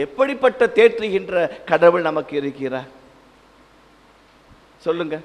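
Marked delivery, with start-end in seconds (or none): none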